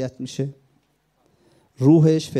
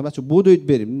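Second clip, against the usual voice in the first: calling out; 3 semitones higher, 10 dB louder than usual. Retold or imitated. Retold battlefield call to comrades.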